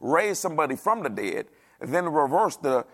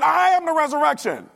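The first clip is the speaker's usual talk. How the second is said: loud, high-pitched preacher's holler; imitating a thundering, high shout; hollered declaration; 12 semitones higher, 9 dB louder than usual. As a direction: holler it out at full force, pitch way up. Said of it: "he hollered at that woman".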